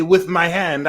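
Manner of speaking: normal talking voice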